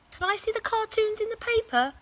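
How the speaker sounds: put-on high, loud child's question; Laura pestering mum; eager girl. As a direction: ask keenly like a little girl. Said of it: "asked Laura".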